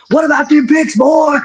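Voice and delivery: high mocking taunt at the pickers; mocking heckler. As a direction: needle them like a rowdy fan. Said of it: "they're gonna come to you and say".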